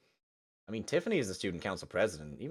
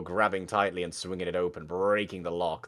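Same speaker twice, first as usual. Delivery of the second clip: usual speaking voice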